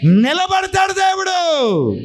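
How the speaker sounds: high, climbing preacher's cry, rising with each phrase; full-force preaching. Acted. Pastor testifying to the congregation, preaching at full force. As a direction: preach it out to the back row.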